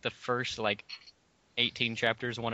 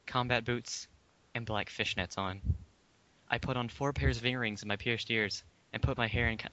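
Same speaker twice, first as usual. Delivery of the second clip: ordinary talk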